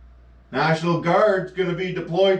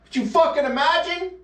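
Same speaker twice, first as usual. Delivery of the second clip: normal talking voice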